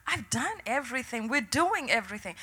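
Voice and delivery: very soft, worn-out plea; re-enacted hushed frustration; quiet exasperation. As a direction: say it under your breath, at a loss. Retelling worn-out plea at night.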